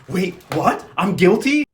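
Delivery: mock disbelief, rising at the end; halting, incredulous retold reaction; caught off guard. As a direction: imitate a stunned defendant, building in volume. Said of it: "was so caught off guard".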